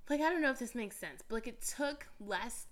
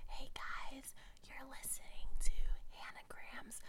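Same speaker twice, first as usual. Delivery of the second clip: ASMR whisper intro; 3 semitones higher than usual. Shown as a demonstration. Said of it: "whenever I whisper".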